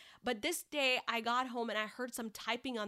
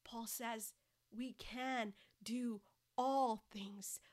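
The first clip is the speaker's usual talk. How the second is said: slow, intimate whisper; whispered encouragement; 5 dB quieter, slower than usual. Acted. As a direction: lean in and whisper the promise slowly. Intimate closing exhortation, whispered.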